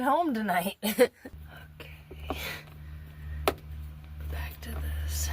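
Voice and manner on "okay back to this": whispering. Real, not acted. Muttering to himself.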